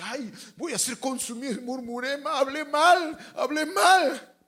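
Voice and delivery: high, loud lament, slowed on hablé mal; mock repentant wail. A congregant confessing gossip, high and wailing. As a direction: ham up the guilty gossip's wail.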